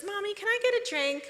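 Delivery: put-on high, small child asking sweetly; little girl voice. Seven-year-old girl on the stairs asking for a drink, high child voice. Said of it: "she comes down and she says".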